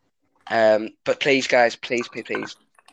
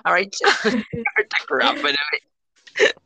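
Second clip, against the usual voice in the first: imitating brother; 7 semitones higher, slower than usual. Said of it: "my brother ... went".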